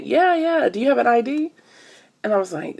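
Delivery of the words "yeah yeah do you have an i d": high eager clerk voice; imitating clerk. Clerk asking her for ID, high imitation. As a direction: mimic the pushy clerk, pitched up. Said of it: "he was like".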